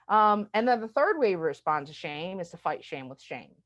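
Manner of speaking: normal talking voice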